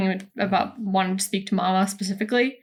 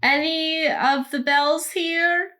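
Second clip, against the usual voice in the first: calling out; 8 semitones higher than usual. Pretend. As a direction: call politely through a closed door. Calling in through a closed door.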